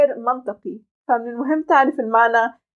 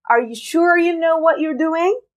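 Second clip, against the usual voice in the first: "know" stressed, "you" reduced, slow rising question; 5 semitones higher, much slower than usual. Shown as a demonstration. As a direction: lean on "know", swallow "you". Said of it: "هذه الكلمة know عملنا لها ستريس". (شدد على know وخفف you)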